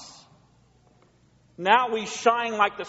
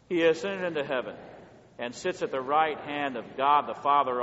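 creed recitation; 6 semitones lower, 4 dB quieter than usual. Real, not acted.

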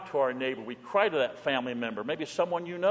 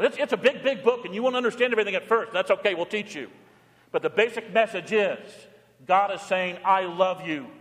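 high, loud sermon voice; impassioned sermon; fervent preaching; 5 semitones higher, 4 dB louder than usual. Acted. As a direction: preach the good news with fire. Preaching at full force.

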